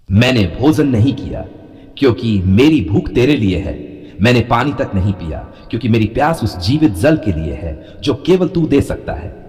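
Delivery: ordinary talk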